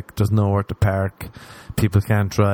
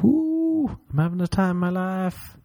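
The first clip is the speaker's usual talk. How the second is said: mock party whoop; 10 semitones higher than usual. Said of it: "Like people on Instagram".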